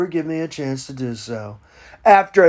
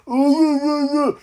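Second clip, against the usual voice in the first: high hammed-up ooh ooh wails; mock crying whoops; 7 semitones higher than usual. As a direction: fake a whimpering cry mid-speech. Mock sob mid-list.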